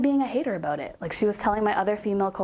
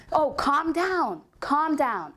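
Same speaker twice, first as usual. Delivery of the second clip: slow, higher, condescending mock of the coworker; mocking calm down; 7 semitones higher, much slower than usual. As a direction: drag out calm down to belittle her. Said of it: "she went".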